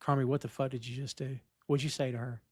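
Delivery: understated imitation of Richie, calm and soft; mild-mannered. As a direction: ask it mildly with no heat at all. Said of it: "Very mild-mannered".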